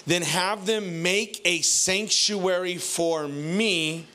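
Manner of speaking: usual speaking voice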